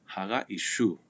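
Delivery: normal talking voice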